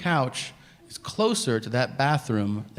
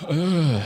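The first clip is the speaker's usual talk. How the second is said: higher, short grunt of overeating; out-of-breath groan; 5 semitones higher, 3 dB louder than usual. Retold or imitated. Man eating himself out of breath, imitated groan. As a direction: groan as if winded by food. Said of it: "and then I go".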